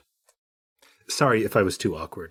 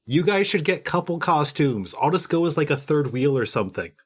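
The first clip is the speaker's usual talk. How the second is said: raised, higher-pitched call; 7 semitones higher than usual. Acted. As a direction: call after someone who is walking off. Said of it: "I'm just gonna be calling out".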